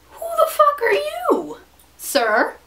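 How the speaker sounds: high indignant challenge. Real, not acted. Vlogger challenging a man who calls her vain, high indignant demand. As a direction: call him out, high and sharp.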